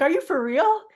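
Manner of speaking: high, loud rising disbelief; can't believe it's really happening; disbelieving. Retold or imitated. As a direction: sister overwhelmed by the gift, high loud disbelief. Sister tearful, disbelieving.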